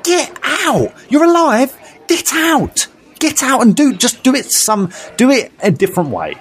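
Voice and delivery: high, pleading rant at the screen; exasperated rant. Real, not acted. Fan ranting at a movie character, exasperated and high. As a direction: get out, you're alive, high and worked up.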